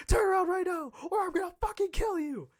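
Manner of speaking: high, screamed threat; loud, high-pitched mom rage; furious mom yelling. Retold or imitated. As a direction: shriek an order at your kid. Mom threatens him on phone.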